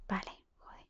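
real hushed whisper to self; barely audible, voiceless aside. Real, not acted. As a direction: whisper it under your breath. Sneaking about, muttering to self.

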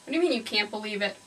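usual speaking voice

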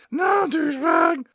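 high, slow mock denial; bratty kid role. Mall rep denies it and insults owner, high whiny kid. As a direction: deny it in a drawn-out high kid voice, insult attached. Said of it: "And he's like".

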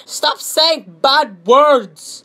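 mock scolding commenter. Reading another complaint about bad words, slow mock scolding. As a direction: drag out each word in mock reproach. Mocking stop-swearing comments read aloud.

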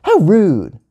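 sharp, high-pitched outburst; indignant yell. A narrator's reading. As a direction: yell it like an offended kid. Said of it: "Gia yelled".

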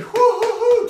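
high, loud triumphant whoops; excited whoop. Real, not acted. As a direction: cheer it out at full pitch. Coach cheering mid-workout.

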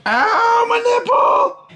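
high, sharp cry of pain; pained yelp. Real, not acted. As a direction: yelp as if suddenly hurt. Dog jumps on him.

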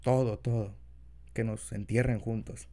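put-on soft, low boyfriend voice; tender, shy lover, quiet; incubus. Continues confession to partner.

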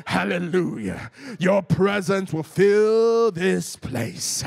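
loud, drawn-out mock American cowboy prayer; hammed-up American cowboy preacher, stretching words out big; American cowboy accent. Preacher imitating pastors praying on stage, booming fake American cowboy accent. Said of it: "he turns into some American cowboy".

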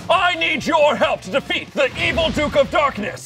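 booming heroic call to arms; rally the kids like a superhero. Acted. Hero calls kids to action.

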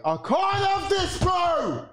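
strained, desperate roar; anguished shout. Real, not acted. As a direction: yell it at the telly, at the end of your tether. Fan losing it at the match.

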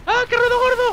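high loud yelp; startled shout. Real, not acted. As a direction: yell in sudden shock.

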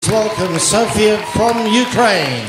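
loud, ringing host introduction; announcer welcome. Real, not acted. Host announcing the semi-finalist in a big, loud voice. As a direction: call her name out to the hall.

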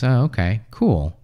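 ordinary talk, nothing marked

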